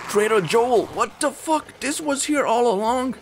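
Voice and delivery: very high, excited shriek of disbelief; astonished. Real, not acted. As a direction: squeal at an unbelievable find. Discovers another trader nearby.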